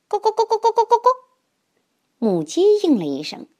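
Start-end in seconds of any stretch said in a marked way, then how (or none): 0.1-1.1 fast high clucking; hen clucking. Acted. Hen answers the duck.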